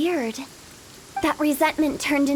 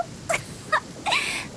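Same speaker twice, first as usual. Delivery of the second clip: mischievous giggle; 6 dB quieter than usual. Acted. Hu Tao laughs at the idea.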